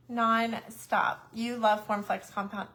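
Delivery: ordinary talk, nothing marked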